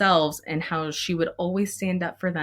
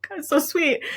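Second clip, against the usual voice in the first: much higher, laughing and gushing; laughing through tears; 12 semitones higher than usual. Real, not acted. Teacher gushing about the kind admin, high and laughing. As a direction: squeak it out, choked up with joy.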